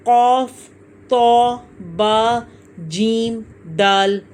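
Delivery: "क़ाफ़, तो, बा, जीम, दाल" each said slowly and separately; "क़ाफ़" and "तो" full and drawn out, one at a time; letter names recited. Shown as a demonstration. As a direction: call out each Arabic letter name distinctly, with long vowels. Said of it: "ये कौन कौन से हैं?".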